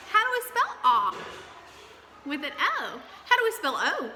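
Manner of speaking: lively call-and-response teacher; quiz call. Shown as a demonstration. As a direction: call the question out to the class. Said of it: "quiz calls to class".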